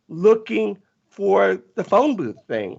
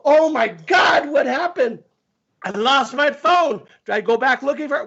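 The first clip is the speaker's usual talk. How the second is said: retold alarmed cry; 7 semitones higher than usual. Retelling his alarm in the water.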